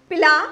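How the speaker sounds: पिला with a short first i; short vowel. Shown as a demonstration. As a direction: say पिला quickly and firmly, first vowel brief. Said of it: "उस सुर को लंबा खींचेंगे".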